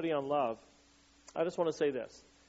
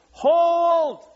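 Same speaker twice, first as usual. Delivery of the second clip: much higher, much louder shouted "Hold!"; battle scream; 14 semitones higher, 13 dB louder than usual. Retold or imitated. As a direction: scream it to soldiers holding the line. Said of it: "he just keeps screaming to his men".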